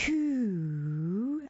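long, eerie drawn-out call; stretched-out spooky monster wail; icy monster voice. A narrator's reading. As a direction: call coldly from under the bed. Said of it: "an icy voice called".

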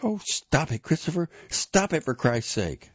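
high, exasperated outburst; angry yelling. A narrator's reading. Wife scolding Christopher before his mother, angry yell. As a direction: snap at him, pitched right up. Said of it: "so openly yelled at by his wife".

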